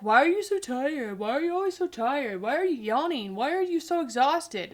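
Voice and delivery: exasperated parody of nosy questions; mock nagging. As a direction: imitate people pestering her. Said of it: "everybody's like".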